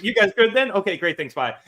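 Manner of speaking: high, loud, giggly sign-off; laughing nervous boss. Retold or imitated. Imitating the boss backing out of the room, laughing. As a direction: rush out the goodbye while laughing.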